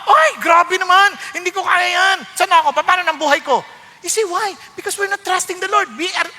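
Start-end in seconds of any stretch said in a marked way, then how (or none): 0.0-3.7 1 mock dismay. Acting out reluctant believer.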